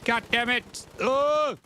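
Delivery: high, loud frustrated yell; angry outburst. Real, not acted. Frustrated shout in game.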